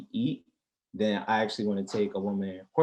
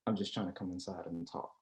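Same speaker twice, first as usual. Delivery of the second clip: much softer seductive line; smooth line; 4 semitones lower, 11 dB quieter than usual. Retold or imitated. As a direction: murmur the pickup line. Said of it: "hit her with the".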